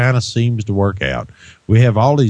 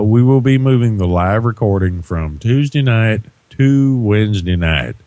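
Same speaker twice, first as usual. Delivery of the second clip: ordinary delivery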